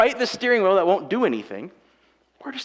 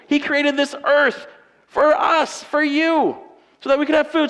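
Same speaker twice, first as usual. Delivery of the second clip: higher and louder, fervent proclamation; impassioned preaching; 8 semitones higher, 4 dB louder than usual. Real, not acted. Preacher proclaiming God's care to the congregation, fervent. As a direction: build it up, preaching with full conviction. Preaching climax after repetition.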